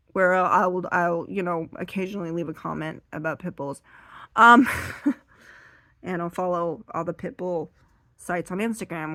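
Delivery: ordinary talk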